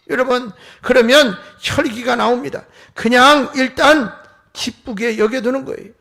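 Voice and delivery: loud, emphatic pulpit exhortation; forceful preaching. Real, not acted. Preacher urging congregation to hold back anger, forceful. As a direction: press the congregation hard, punching key phrases.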